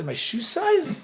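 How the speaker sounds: high, laughing, puzzled question. Retold or imitated. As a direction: ask it laughing in disbelief. Confused by British word boot.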